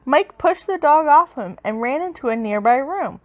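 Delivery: usual speaking voice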